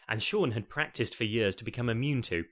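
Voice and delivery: ordinary delivery, nothing marked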